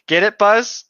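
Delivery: high, loud "get it?" nudge; hammed-up punchline delivery; teasing pun. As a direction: sell the joke with a grin. Punning on severed arm.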